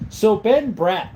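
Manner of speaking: usual speaking voice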